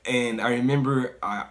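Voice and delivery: ordinary delivery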